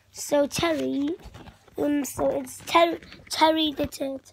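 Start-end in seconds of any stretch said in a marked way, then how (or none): none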